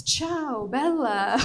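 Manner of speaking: put-on lightly Italian-accented phone hello; a hint of an Italian charmer answering the phone; Italian greeting.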